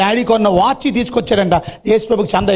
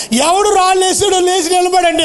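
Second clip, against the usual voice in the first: pastor demanding; 11 semitones higher than usual. A narrator's reading. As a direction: call the class out sharply. Pastor demands culprit stand.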